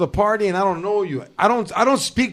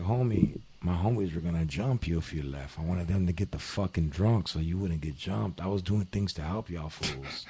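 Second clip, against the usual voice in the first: low soothing explanation; 11 semitones lower, 12 dB quieter than usual. Retold or imitated. Telling the rival he was protecting him, low and soft. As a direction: lower and much softer, calming him down.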